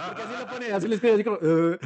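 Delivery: laughing through the retelling. Real, not acted. Amused while telling a friend about the texts, laughing. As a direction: giggles breaking into the words.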